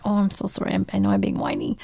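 apologetic. Retold or imitated. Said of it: "if I'm being whiny".

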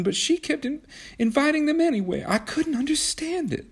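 high, broken, tearful voice; weeping. A narrator's reading. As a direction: stammer through the tears. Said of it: "Kismene began to weep".

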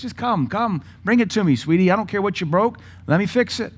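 put-on high, gentle voice to a child; sweet tender parent coaxing. Voicing tender father to child.

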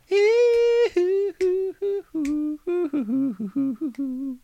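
long high-pitched cackle; high cackling laugh. Real, not acted. Laughing at own election joke, a long high cackle. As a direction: let out a shrill drawn-out cackle.

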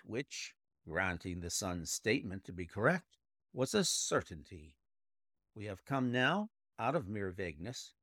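plain reading voice

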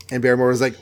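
ordinary delivery

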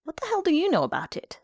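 high, fast angry retort; fierce retort. A narrator's reading. Beaten man turning on the curate, fierce. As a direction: round on him fiercely. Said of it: "said Farrington fiercely".

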